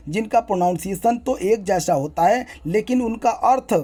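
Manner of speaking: ordinary delivery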